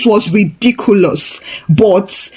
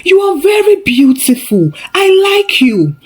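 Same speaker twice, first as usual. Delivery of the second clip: put-on lightly imagined Chinese accent, higher pitched; soft foreign accent; 9 semitones higher than usual. A narrator's reading. Ose imagines a Chinese billionaire admiring her, soft Chinese accent. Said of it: "saying in a soft accent".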